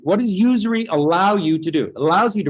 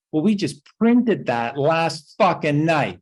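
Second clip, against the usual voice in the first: usual speaking voice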